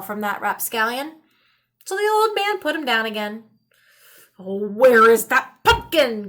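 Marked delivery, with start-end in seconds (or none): 4.4-6.3 croaked. A narrator's reading. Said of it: "croaked the old man".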